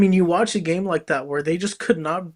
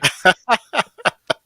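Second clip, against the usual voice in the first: loud, high burst of laughter; amused laugh, higher and louder; 5 semitones higher, 8 dB louder than usual. Real, not acted. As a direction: laugh out loud after talking over each other. Interrupted by crosstalk, laughs it off.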